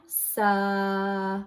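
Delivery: sa stretched as a drawn out segment; sounding out. Shown as a demonstration. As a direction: say sa slowly, sounding out the vowel. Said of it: "Then I say the next sound".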